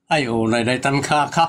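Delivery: usual speaking voice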